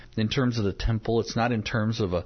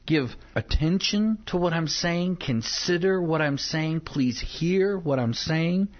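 slow, higher-pitched plea in prayer; earnest pleading; 5 semitones higher, slower than usual. Acted. David begging God to hear him, acted pleading. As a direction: plead with measured, drawn-out emphasis.

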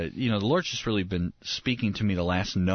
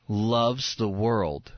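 slow emphasis; much slower than usual. Shown as a demonstration.

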